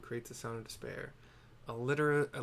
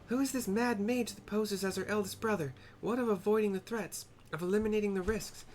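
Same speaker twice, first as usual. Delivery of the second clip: high-pitched, questioning character voice; indignant mage brother; 9 semitones higher, 3 dB louder than usual. Acted. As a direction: challenge them in a high, theatrical voice.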